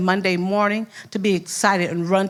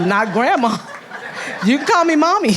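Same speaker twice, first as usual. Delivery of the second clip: higher, louder, laughing through it; high, loud, giggling correction of a child; laughing playful correction; 8 semitones higher, 4 dB louder than usual. Retold or imitated. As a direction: tease the child while giggling. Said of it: "I'm like".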